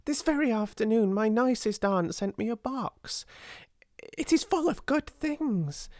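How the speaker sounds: high, eager rush of news; excited hurry. A narrator's reading. Ermengarde telling Sarah about aunt's box, excited hurry. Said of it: "said Ermengarde in an excited hurry".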